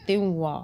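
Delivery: त्युवा with a faint nasal hum before wa; light noon sound. Shown as a demonstration. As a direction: demonstrate त्युवा, n barely sounded. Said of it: "नून की हल्की सी आवाज़ आएगी".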